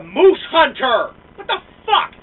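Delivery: loud, slow, incredulous outburst; shocked shout at the picture; outraged yell. Real, not acted. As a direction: yell it in disbelief. Outraged rant at a photo.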